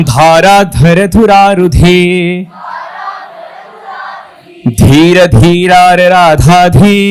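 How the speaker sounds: solemn recited verse; verse recitation. Acted. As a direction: intone each word with weight and long pauses.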